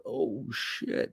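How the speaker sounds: breathy under-the-breath curse; hushed oh shit. Retold or imitated. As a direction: mutter it in quiet dismay. Quietly reacting after the fire.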